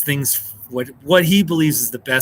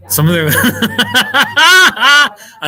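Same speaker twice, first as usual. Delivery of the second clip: laughing; 9 semitones higher, 9 dB louder, much slower than usual. Real, not acted. Talking about Scottish accents to viewers, laughing loudly. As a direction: laugh through the words, loud and slow.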